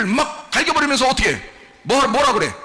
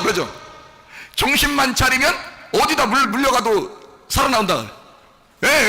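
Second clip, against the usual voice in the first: ordinary delivery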